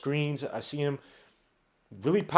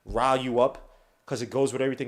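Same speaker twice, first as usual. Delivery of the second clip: ordinary delivery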